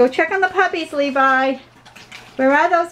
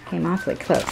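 ordinary delivery

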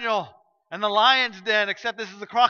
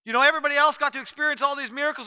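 ordinary delivery

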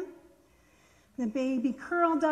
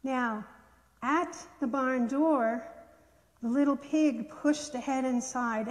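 ordinary delivery

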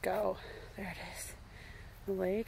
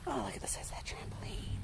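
whispering; 10 dB quieter, faster than usual. Real, not acted. Whispering in awe.

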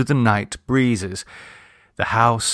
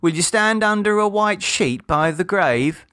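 put-on high child's voice with a laugh; a child enjoying the bargain; mocking child; 9 semitones higher than usual. A narrator's reading. Children set terms for penance.

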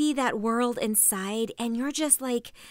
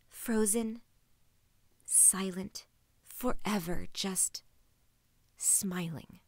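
softer, sinking, word by word; quiet desolation; 3 semitones lower, 7 dB quieter, slower than usual. Acted. Lonely friend seeing herself as a frozen picture, quietly desolate. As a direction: let each word sink into a bleak hush.

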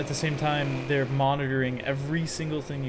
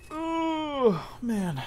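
stretching groan; 11 semitones higher than usual. Real, not acted. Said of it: "Sorry, stretching".

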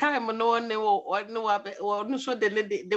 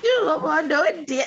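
loud, higher-pitched laughing outburst; 4 semitones higher, 4 dB louder than usual. Real, not acted. Caller breaking up at the host's remark, laughing. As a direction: burst in laughing as you speak.

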